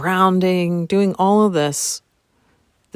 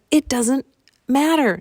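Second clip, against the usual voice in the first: much higher, bright "It doesn't matter"; joyful freedom; 10 semitones higher than usual. Retold or imitated. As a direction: say "It doesn't matter" as a happy release. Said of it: "I felt this freedom and joy".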